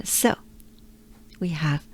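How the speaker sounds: normal talking voice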